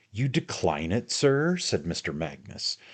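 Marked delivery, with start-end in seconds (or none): 0.1-1.6 indignant challenge. Acted. Magnus challenging Pickwick.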